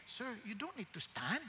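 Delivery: very soft, deferential murmur; deferential aide. Retold or imitated. Aide tells the seated president he needn't stand, softly deferential. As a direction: imitate someone discreetly addressing a superior. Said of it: "Tapos sabi nung ano".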